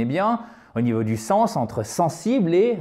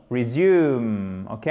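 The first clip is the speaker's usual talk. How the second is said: ordinary talk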